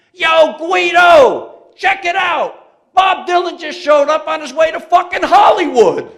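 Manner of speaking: loud high mocking yell, drawn out; yelling across factory. Factory worker yelling to a coworker, loud mocking holler. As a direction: holler it back into the factory floor. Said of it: "yells back in the factory".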